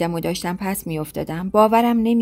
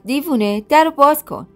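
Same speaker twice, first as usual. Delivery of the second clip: mild mock yell; 7 semitones higher, 3 dB louder than usual. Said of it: "همیرا داد زد".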